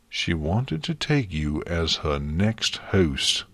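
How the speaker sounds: ordinary delivery, nothing marked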